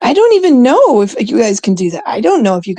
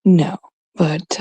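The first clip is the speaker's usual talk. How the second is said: ordinary talk, nothing marked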